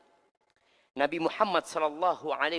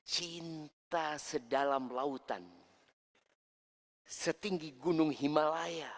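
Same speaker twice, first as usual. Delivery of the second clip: poetic love answer; slower than usual. Retold or imitated. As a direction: spin out each image slowly. Husband's poetic love reply.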